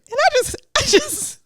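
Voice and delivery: very high, giddy squeal of delight; gush, overcome with pride; high-pitched happy swooning. Real, not acted. Gushing over a proud moment.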